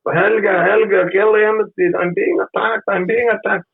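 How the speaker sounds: hammed-up high call for rescue; mock panicked plea. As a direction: squeal for help like a frightened victim. Pleading to the tourist, mock panic.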